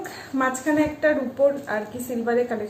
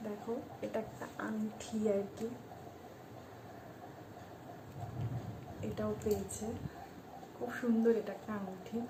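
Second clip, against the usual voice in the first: speaking softly; 16 dB quieter than usual. Real, not acted. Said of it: "আমি এই জন্য আস্তে আস্তে কথা বলছিলাম".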